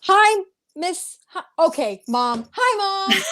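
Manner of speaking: ordinary delivery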